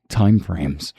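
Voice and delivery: triumphant loud call; loud declaration. Acted. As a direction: shout the answer time frames. Dramatic game deduction line.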